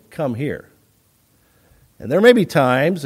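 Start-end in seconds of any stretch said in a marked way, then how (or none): 0.1-0.7 low, quiet, stern parental order; mildly stern parent; a little stern. Shown as a demonstration. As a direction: tell a child off lightly, keeping it low. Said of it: "you get a little stern".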